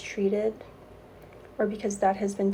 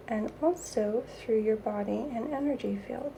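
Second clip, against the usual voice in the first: airy breathy mystical healer; 4 dB quieter than usual. Acted.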